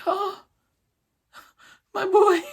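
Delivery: very high, squeaky mock sob; hammed-up weepy farewell; mock tearful cry. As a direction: wail it in falsetto as if weeping. Acting out tearful parting.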